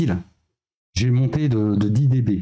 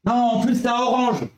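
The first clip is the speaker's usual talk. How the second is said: excited; 10 semitones higher than usual. Real, not acted. Noticing the lighter, teasing.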